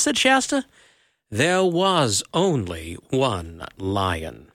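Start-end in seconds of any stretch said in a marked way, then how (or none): 1.3-4.5 low, slow and grave; booming male voice. A narrator's reading. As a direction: speak down calmly as the lion. The voice answers Shasta.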